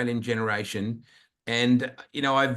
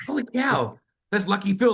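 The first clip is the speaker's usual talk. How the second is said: high, excited gasp of recognition; retold starstruck amazement; 9 semitones higher, 4 dB louder than usual. Host reliving spotting a TV crew member at rehearsal, starstruck. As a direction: jump up in pitch, thrilled. Retelling starstruck reaction backstage.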